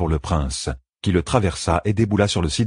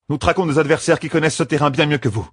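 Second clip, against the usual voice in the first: higher-pitched, earnest warning; young woman leader, cautioning; 9 semitones higher, faster than usual. A narrator's reading. Young leader warning matriarchs about their adversaries, cautioning. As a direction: warn them as a young leader.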